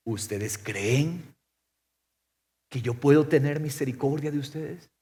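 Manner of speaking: hushed, unhurried asking; gentle question. Acted. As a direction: ask it quietly, drawing out the first words. Preacher voices Jesus asking gently.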